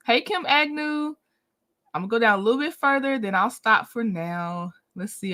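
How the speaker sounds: ordinary delivery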